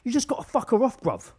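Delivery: hammed-up bloke giving advice; geezer quote. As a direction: belt it like a lad in the van. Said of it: "I heard one of them just go".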